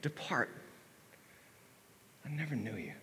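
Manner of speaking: final quiet dismissal; Jesus turns the pleaders away, quietly; solemn verdict. Acted. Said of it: "Jesus is gonna say".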